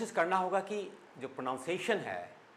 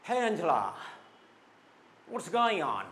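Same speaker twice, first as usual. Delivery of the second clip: lightly louder call to Angela; friendly greeting; 4 semitones higher, 4 dB louder, much slower than usual. Shown as a demonstration. Teacher acts greeting Angela, a bit louder. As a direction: greet Angela across the room. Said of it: "okay?".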